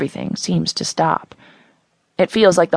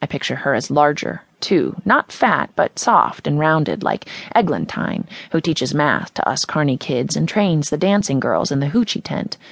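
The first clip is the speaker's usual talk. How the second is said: usual speaking voice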